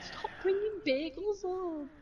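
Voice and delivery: high, drawn-out parental moan; mock whine of exasperation, slowly dragged out; exasperated plea.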